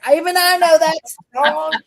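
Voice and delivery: can't believe how wrong that is; exasperated. Real, not acted. Telling friends the tire guy's answer, exasperated.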